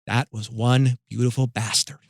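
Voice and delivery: Dave Damashek. Retold or imitated. Dave Damashek to the group once Beckham is out of earshot, low impression. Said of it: "you sound exactly like Dave Damashek".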